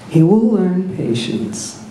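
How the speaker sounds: slow, distant calm voice; distant Obi-Wan. A narrator's reading. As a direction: speak as if from far away. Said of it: "a familiar voice".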